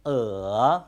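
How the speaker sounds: Uh dipping low then rising; long, low scooping Uh; third tone on Uh. Shown as a demonstration. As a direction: drop Uh down and curve it up. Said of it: "and the third".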